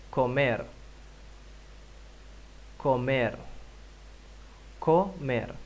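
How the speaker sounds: Comer as komer, clear and even; model pronunciation. Shown as a demonstration. Teacher models the verb for repetition. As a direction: model Comer for the class to echo. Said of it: "repite por favor".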